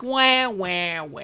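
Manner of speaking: spoken fake bawling; mock crying wah. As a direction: imitate a crybaby, mockingly. Mocks Paul's self-pity.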